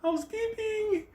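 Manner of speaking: very high, drawn-out singsong call; mock senora announcing herself in falsetto; housekeeper calling out. Senora at the hotel room door, singsong housekeeper call. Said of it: "senora is coming to the room".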